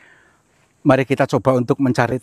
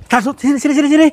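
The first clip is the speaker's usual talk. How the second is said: urgent calling; 11 semitones higher, 4 dB louder, faster than usual. Real, not acted. Urgently calling companion over.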